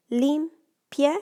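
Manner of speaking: Limpie in two loud, separate beats, LIM, PIE; split into syllables. Shown as a demonstration. Teacher counting syllables for students, Limpie broken into LIM and PIE. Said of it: "that's actually just two syllables".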